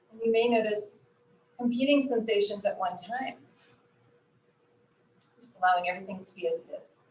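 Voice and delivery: calm, unhurried voice with long pauses; guided meditation. Acted. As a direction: ease them gently into noticing body and breath. Leads a body scan.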